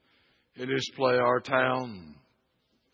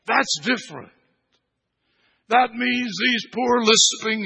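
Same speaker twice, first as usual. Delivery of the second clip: loud, raised, driving sermon voice; full-force preaching; 7 semitones higher, 7 dB louder than usual. Acted. Preacher declaring the creed of resurrection, forceful full-voiced preaching. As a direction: proclaim it forcefully to the whole church.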